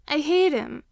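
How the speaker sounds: put-on child's bitter cry. A narrator's reading. Sobbing child speaks first.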